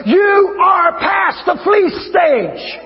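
high, very slow preacher's shout; shouted proclamation. Real, not acted. Preacher declaring to the Rejoice congregation, high drawn-out proclamation. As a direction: proclaim it, stretching every word.

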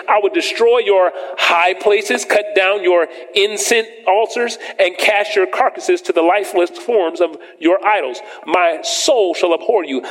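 preacher reading the judgments to his church; scripture reading. Acted. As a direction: lay each threat down slowly. Reads scripture slow and high.

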